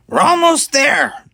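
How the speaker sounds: high, loud, slow gloating shout; triumphant shout. A narrator's reading. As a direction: crow with triumph at the ship. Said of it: "Talus shouted triumphantly".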